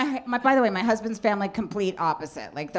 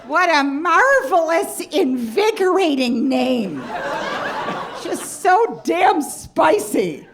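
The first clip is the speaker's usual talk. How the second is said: high, loud, slow over-gushing liberal mom; 8 semitones higher, 5 dB louder, much slower than usual. Retold or imitated. Daughter mimics her mom fawning over a cab driver's name, gushing high. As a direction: savor the name like a fine wine, way up high. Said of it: "She'll be like".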